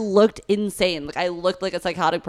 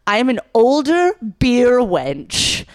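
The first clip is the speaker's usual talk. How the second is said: slow, higher, louder mock-grand declaration; lightly played older character, drawn out; hammed-up older beer wench; 6 semitones higher, 5 dB louder, slower than usual. Actress recalling her opera role, mock-grand and slow. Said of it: "I'm a character actor".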